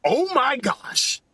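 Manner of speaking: high, slow, drawn-out gasp of shock; wife's text read aloud. Retold or imitated. Wife texting about a secret, slow high gasp. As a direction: stretch it out like a scandalized wife. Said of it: "She says".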